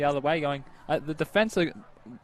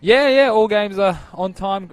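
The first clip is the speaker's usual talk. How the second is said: loud; 6 semitones higher, 12 dB louder, slower than usual. Real, not acted. Cheerful report on the day.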